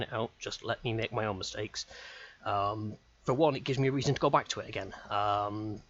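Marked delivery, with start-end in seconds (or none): none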